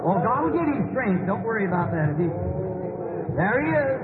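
high, ringing call to rise up and praise; preacher urging the whole crowd up, pitch climbing; rising preacher's climax. Real, not acted. Healer calling the crowd to stand and praise, in a high, ringing preaching climax.

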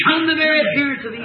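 high, fast warning; fervent preaching. Real, not acted. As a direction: drive the warning home from the pulpit. Preacher warns the congregation.

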